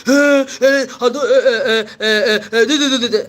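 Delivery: loud flustered stammer, half laughing; mock the doctor's panicked stutter.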